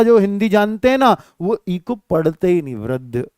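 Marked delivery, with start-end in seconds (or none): 2.7-3.3 वृद्ध said lower and softer, no final इ; teacher mimics fluent Hindi readers skipping the इ; wrong pronunciation. Lower, softer mock वृद्ध. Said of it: "बस ऐसे पढ़ेंगे".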